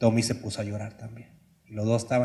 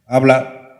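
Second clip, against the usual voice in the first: curt father; 9 dB louder than usual. Retold or imitated. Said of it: "sin quitar el periódico".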